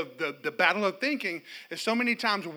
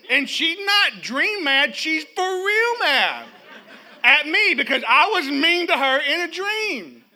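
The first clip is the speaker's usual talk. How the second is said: normal talking voice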